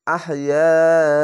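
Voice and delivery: drawn-out chanted reading of তাকুলু, vowels held long; slow Quran recitation. Shown as a demonstration. Teacher demonstrating tajweed to students, slow chanted recitation. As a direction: recite তাকুলু slowly, stretching each vowel. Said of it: "চার আলিফ টেনে পড়বেন".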